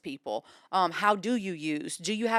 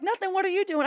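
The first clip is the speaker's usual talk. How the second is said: high-pitched imitated sister; breezy chitchat; 12 semitones higher than usual. Sister answering a casual phone call, breezy chitchat. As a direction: toss it back lightly, pitched way up.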